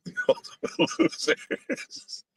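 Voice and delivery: laughing through the words; high, breathy laugh-talk. Real, not acted. Repeats his own line, laughing.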